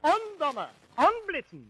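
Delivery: high, loud hunting calls; mock calling to hounds. [name] shouts to the hounds, mock hunting calls. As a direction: call out to the hounds across the field. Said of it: "I can hear myself now calling to the hounds".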